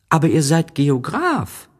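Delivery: put-on high child voice, puzzled; a boy can't believe it; little prince protesting. A narrator's reading. Little prince objects to the geographer, high and puzzled.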